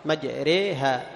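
"مجريها" slow, with the ra leaning toward kasra and the alif tilted toward ya; imala. Shown as a demonstration. Teacher modelling the Hafs reading with imala. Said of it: "كسرة الراء وإمالة الألف".

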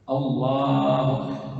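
slow, chanted "الله" with a held "laa"; long vowel in "الله". Shown as a demonstration. Teacher repeating the correct takbir, madd on "الله". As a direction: lengthen "الله", keep "أكبر" short. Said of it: "في لفظ الجلالة".

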